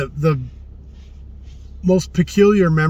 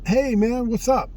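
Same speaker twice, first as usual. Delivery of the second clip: lightly voiced buddy greeting, pitched up high; breezy high imitation of a friend saying hi; casual friendly greeting; 9 semitones higher than usual. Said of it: "somebody going".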